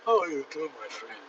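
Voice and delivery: a light put-on African accent; friendly African accent, lightly done. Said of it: "African accent".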